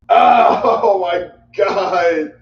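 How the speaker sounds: stunned outburst at the screen, loud and laughing; shocked shout. Real, not acted. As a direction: yell it out in shock, then laugh. Shocked reaction to the show.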